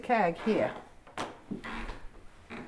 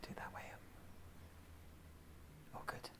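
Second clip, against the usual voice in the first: whispering; 25 dB quieter, much faster than usual. Real, not acted. Whispering during the ghost hunt.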